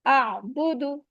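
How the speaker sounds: आ बुदू said very slowly, each vowel one count. Shown as a demonstration. Teacher sounding out आ बुदू slowly, vowels kept short. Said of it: "अब ये one one count है".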